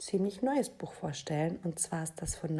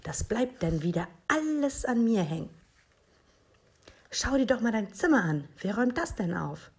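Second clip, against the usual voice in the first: higher, exasperated motherly nagging; scolding; 5 semitones higher, 5 dB louder than usual. A narrator's reading. Mama complains to Minus about chores and his room, scolding. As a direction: scold with punched, rising emphasis. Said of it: "schimpfte Mama".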